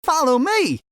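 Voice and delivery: very high, loud eager call; excited call. A narrator's reading. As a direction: dash off calling him along. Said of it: "Fred cried".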